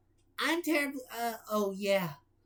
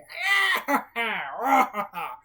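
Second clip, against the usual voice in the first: evil cackle; villain cackle; 2 semitones higher than usual. Acted. Villain laugh.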